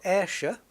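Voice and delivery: Ayrshire with the ending weakened to "shuh"; reduced shire ending. Shown as a demonstration. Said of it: "It's shire in the U K".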